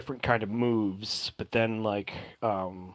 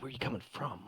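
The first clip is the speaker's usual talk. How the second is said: much softer, pointed ask; quiet pointed question; 14 dB quieter, faster than usual. Acted. Elf quietly pressing the player on origins, soft.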